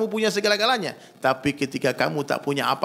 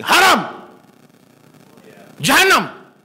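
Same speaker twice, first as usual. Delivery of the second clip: high, explosive one-word verdict; sudden loud imitation of a denouncer; mock condemning shout; 10 semitones higher, 8 dB louder, much slower than usual. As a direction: bellow it like a stern judge, imitating. Retold harsh verdicts on music.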